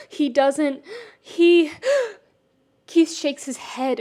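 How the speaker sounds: rising panic. Acted. Keith panicking, gasping as he fights for breath. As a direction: let the panic break through.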